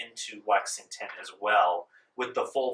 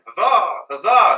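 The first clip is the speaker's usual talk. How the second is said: loud, pitched-up rally cheer; soldiers cheering huzzah; 9 semitones higher, 10 dB louder, slower than usual. Acted. British soldiers rallying after the skirmish, loud cheer. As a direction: chant it like a regiment getting fired up. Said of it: "all the British soldiers start doing".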